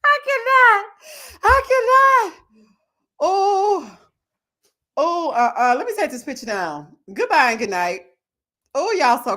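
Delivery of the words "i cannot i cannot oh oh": high squealing laugh through the words; can't get the words out for laughing; cracking up, gasping out I cannot. Real, not acted. Laughing hard at a picture.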